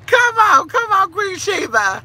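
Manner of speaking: high loud excited call; excited shout. Real, not acted. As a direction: cheer her name. Excited greeting to viewer.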